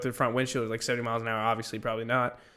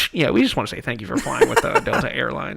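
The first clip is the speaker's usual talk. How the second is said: louder captain thank-you announcement; pilot cockpit headset; 5 dB louder than usual. Retold or imitated.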